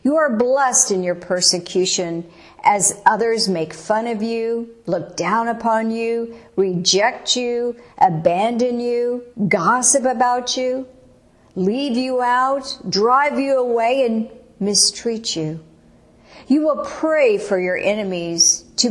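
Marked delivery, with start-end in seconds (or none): none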